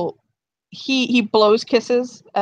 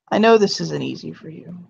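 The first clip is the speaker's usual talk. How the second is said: ordinary delivery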